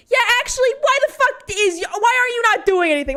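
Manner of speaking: loud, high angry yelling; furious mom. Retold or imitated. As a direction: scream at the school staff. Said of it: "started screaming at them".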